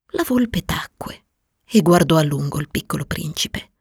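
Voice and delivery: plain reading voice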